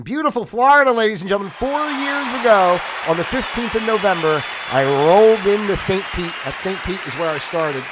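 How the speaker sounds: loud, high-pitched showman announcement; announcer. Acted. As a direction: proclaim it big, like an emcee. Announces his Florida anniversary.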